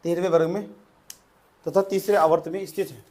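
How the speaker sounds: short phrases with long pauses for writing; dictation. Real, not acted. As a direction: dictate it piece by piece to a class. Dictates notes to the class. (श्रुतलेख की तरह रुक-रुक कर बोलते हुए)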